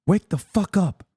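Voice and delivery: high strained hushed yell; whisper scream. Real, not acted. Urging chat awake, a high hushed whisper scream. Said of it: "I have to whisper scream".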